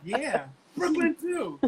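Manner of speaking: slow, high imitation of a Brooklyn lady; mock Brooklyn lady, drawn out; imitating a stranger in a Brooklyn accent. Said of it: "And she was like".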